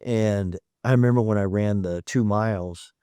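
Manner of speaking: usual speaking voice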